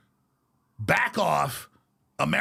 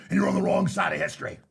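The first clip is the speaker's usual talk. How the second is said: ordinary talk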